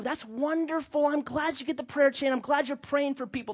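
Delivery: lightly sugary, playing nice to the grandma; overly sweet reply. To the gossiping grandma, mildly sugar-sweet.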